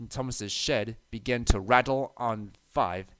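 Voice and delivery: plain reading voice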